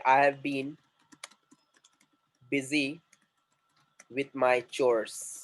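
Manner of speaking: very slow, long pauses between chunks; feed it to learners piece by piece; slow dictation. Shown as a demonstration. Teacher models a new English answer. (बहुत धीरे, रुक-रुक कर)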